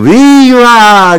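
long, high, full-volume yell; drunkard's shout. A narrator's reading. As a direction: bellow it high and drawn out, as a drunk. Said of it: "gridò un altro ubriaco".

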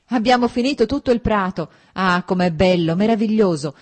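loud, high, bursting with joy; cheerful exclaiming. A narrator's reading. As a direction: burst in shouting happy news. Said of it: "irruppe in camera con un allegro vociare".

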